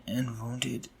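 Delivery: ordinary delivery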